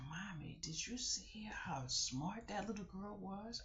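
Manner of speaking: hushed whisper; soft unvoiced whisper; whispering daughter. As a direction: whisper it to mom.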